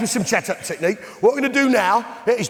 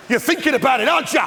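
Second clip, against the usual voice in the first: ordinary delivery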